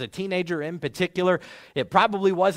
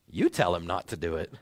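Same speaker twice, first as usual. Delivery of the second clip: lower, softer, passing the job back; deflecting advisor; 12 semitones lower, 5 dB quieter than usual. Retold or imitated. Second advisor pushing the job back, lower and dismissive. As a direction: shrug the task off onto the other man. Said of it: "thinking something along the lines of".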